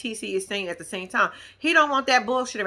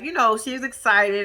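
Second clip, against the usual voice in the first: ordinary talk, nothing marked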